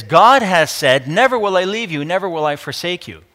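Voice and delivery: strong scripture proclamation; emphatic declaration. Acted. Declaims God's promise from scripture.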